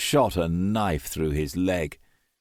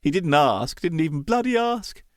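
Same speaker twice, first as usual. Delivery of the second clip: bitter self-reproach; 8 semitones higher than usual. A narrator's reading. Son recalls failing his mother, bitter and anguished. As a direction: voice climbs high in anguish.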